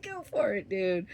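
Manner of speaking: egging him on with a laugh in it; excited urging. Retold or imitated. Urging husband toward other girl.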